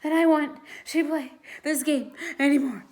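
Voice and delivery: breathless, gasping words; panting after the chase; out of breath. A narrator's reading. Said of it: "in between gasps of air".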